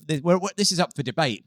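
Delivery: usual speaking voice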